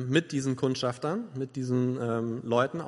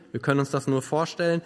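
normal talking voice

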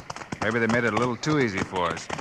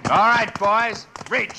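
higher, louder call to raise hands; commanding shout; 7 semitones higher, 3 dB louder, slower than usual. Acted. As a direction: catch them by surprise with a shout. Ambush, drawing on outlaws.

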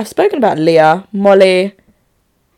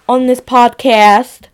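ordinary talk

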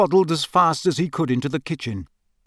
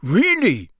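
delighted, higher-pitched exclamation; excited; 5 semitones higher, 4 dB louder than usual. A narrator's reading. Wizard hears of electric fire, excited. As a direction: perk up with sudden fascination. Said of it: "said Mister Weasley's voice excitedly".

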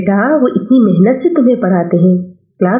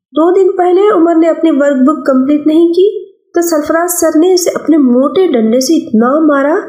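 put-on higher boyish voice, brushing mother off; a busy schoolboy refusing food, a bit higher; young boy; 6 semitones higher than usual. Son refusing his mother's call to lunch, boyish and higher. Child Afaq telling story.

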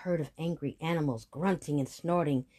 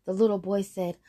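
usual speaking voice

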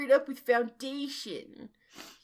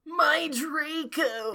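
high, drawn-out mock moan; 6 semitones higher, slower than usual. A narrator's reading. Heartbroken Vampire crying for Draco, moaning. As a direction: wail it slowly, hammed up. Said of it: "he moaned".

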